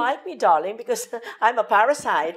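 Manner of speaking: big delighted mock realization; joking exclamation. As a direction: playfully exclaim it, laughing mid-line. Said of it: "may joke ako dyan".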